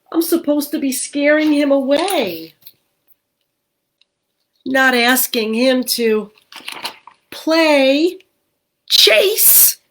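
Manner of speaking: high, rising, exasperated; small ghost voice. Acted. Gilbert dismayed the dog wants to play, high ghost voice. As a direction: complain like a little ghost.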